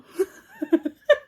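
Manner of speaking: high, loud burst of laughter; amused, high-pitched laugh. Real, not acted. Amused by finding his girdle.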